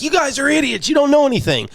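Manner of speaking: high mocking angry commenter. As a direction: whine it like an annoyed fan. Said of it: "we're gonna make some people mad, probably like".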